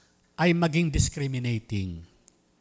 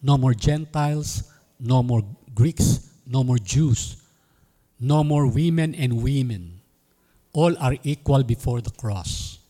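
preaching; 4 semitones lower, 4 dB louder, slower than usual. Acted. Preaching equality, building emphasis.

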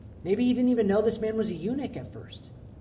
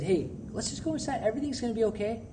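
softer, gentle reassurance; soothing comfort; 8 dB quieter than usual. Retold or imitated. Pastor imagines soothing a sobbing student, soft and gentle. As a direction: coax the crying kid back inside. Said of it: "and said".

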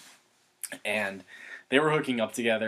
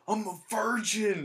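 high, drawn-out mock scream; imitated drunken scream; 13 semitones higher, slower than usual. Naked friend screaming at a pool party, mock scream. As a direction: holler it slowly, way up high. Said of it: "he was just screaming".

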